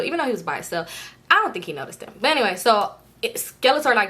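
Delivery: ordinary talk, nothing marked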